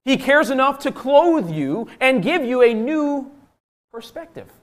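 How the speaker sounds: slow, high, emphatic proclamation; impassioned preaching. Real, not acted. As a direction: build it up word by word from the pulpit.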